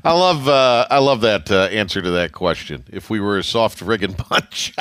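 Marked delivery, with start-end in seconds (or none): 2.9-4.8 laughing. Real, not acted. Host repeats quote amused.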